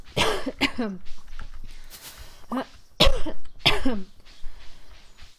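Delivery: long harsh coughing fit, breath rasping; really cough, unable to stop for a few seconds. Real, not acted. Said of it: "My apologies for that".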